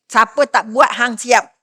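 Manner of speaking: loud threatening warning; king's threat. Retold or imitated. King warning anyone who refuses, loud threat. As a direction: threaten the people like a tyrant. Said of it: "itu perintah Raja Namrud".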